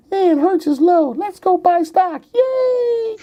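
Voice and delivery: high giddy mock voice of a clueless buyer; naive investor voice. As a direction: squeal it like an overeager newbie. Mocking naive new investors.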